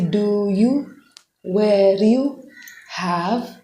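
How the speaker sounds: "do you", "where you", "have" each spoken slowly and apart; said separately. Shown as a demonstration. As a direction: pronounce "do you", "where you", "have" one by one, carefully. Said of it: "when you use it individually".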